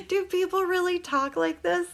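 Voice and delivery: amused, can't believe it, laughing while reading; laughing in disbelief. Real, not acted. Reading a viewer's comment, laughing.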